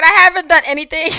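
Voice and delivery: loud, high, rushed mock protest; hammed-up indignant mimicry; mocking imitation. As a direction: parrot her protest at full volume. Mocking a driver from the clip.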